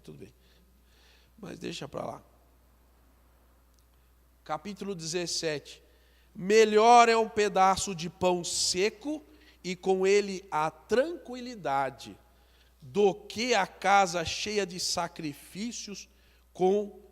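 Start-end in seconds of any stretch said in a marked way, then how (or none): 6.3-17.0 slow, louder, weighty reading of the verse; slow preacher's Bible reading; solemn scripture reading. Acted. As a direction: read it out to the congregation, slowly. Reads chapter seventeen verse.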